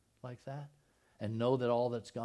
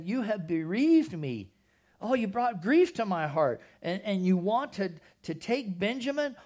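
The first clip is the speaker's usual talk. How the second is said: grieving Jacob; 6 semitones higher, 6 dB louder than usual. Acted. Jacob reproaching his sons, loud and anguished. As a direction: plead like a father losing sons. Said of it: "their father, said to them".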